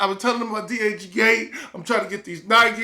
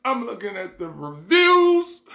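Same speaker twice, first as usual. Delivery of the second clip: ordinary talk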